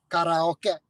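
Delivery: Karaoke said ka-ra-o-ke with pure open vowels; Japanese-style karaoke. Shown as a demonstration. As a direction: give Karaoke its Japanese syllables, ka-ra-o-ke. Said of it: "Japanese syllabic structure is consonant, vowel".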